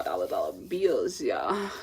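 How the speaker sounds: catchphrase dropped very low; playful low voice. Pretend. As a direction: drop way down for a mock-cool repeat.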